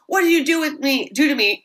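high, urgent imitation of screaming; mock scream. Jake confronts Sandra about his state, mock screaming. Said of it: "Jake's screaming, he's like".

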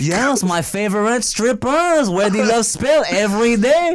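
hammed-up French accent, rising at the end. Praising love spell in a fake French accent. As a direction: boast in a mock-French accent. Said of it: "we are talking in French".

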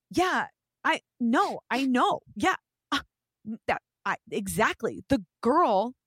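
worked-up phone rant, pitched high; heated. Retold or imitated. Girl on the phone about her stolen purse, heated. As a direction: act out an indignant phone call. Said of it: "in a heated conversation".

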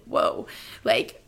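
an unvoiced, amazed whoa; breathless whoa. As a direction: let the whoa out almost without voice. Amazed reaction to the new guy.

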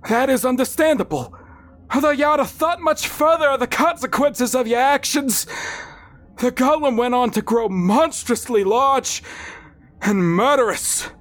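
high, solemn fatherly verdict; father pronouncing judgment. A narrator's reading. Father sentencing his son in hospital, high solemn voice.

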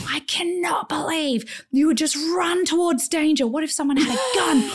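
high, hushed, exasperated scolding; mock furious husband in a whisper; angry husband. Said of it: "like, livid, like, angry at me".

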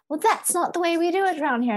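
high, quick prissy British lady; mock a stuck-up British lady, fast and high; British accent. The British woman scolding again, high fast British accent. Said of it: "a British lady".

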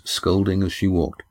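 usual speaking voice

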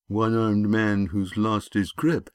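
bully voice, dropped from shouting to musing; pondering own joke. A narrator's reading. Bully realising he made a joke, musing repeat. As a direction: repeat it to yourself, just getting the joke.

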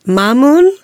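ordinary delivery

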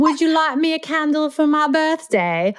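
high-pitched, badly faked British accent. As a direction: attempt a British accent and miss. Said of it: "need to say it in a British accent".

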